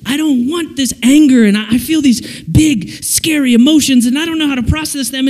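high, agitated whine building up; mock overwhelmed. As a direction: pour it out like a frazzled kid. Acted child overwhelmed by feelings.

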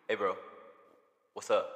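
low, casual bro greeting; straight-guy voice. Shown as a demonstration. Host showing off a put-on straight voice, low bro greeting. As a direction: put on a straight dude's voice, lightly. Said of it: "do you wanna hear my street voice".

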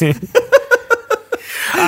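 high-pitched burst of laughter. Real, not acted. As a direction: cackle at the memory. Laughing at the movie scene.

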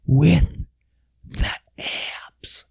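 slow, dramatic whisper with pauses; word by word, sinking into a whisper; hushed awe. Real, not acted. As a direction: drop to a whisper on "the abs". Repeats detail for comic awe.

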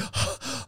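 sharp mock gasp; imitated gasp for air. Trainee in jet's back seat without his mask, imitated gasp. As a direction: suck in air as if starved of oxygen. I can't fucking breathe.

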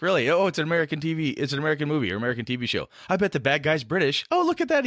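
high, quick excited quoting; imitated UK viewers. UK friends calling the villain out, high mock excitement. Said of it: "I've talked to guys in the U K like".

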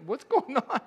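high, loud startled question; hammed-up fright; mock alarm. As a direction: blurt it out in put-on panic. Acted alarm during turbulence.

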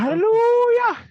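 high, slow, drawn-out hallelujah; preacher hallelujah. Acted. Joking about church and pastors.

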